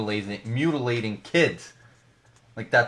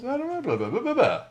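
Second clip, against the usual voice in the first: high-pitched mock babble, sliding down; goofy run of slurred blahs; babbling blah-blah; 12 semitones higher than usual. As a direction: let the words collapse into silly gibberish. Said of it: "Now I'm just going, blah".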